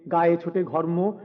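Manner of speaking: plain reading voice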